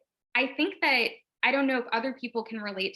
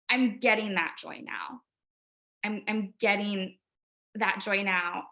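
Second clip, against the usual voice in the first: ordinary delivery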